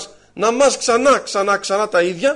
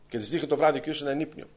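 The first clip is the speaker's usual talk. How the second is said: usual speaking voice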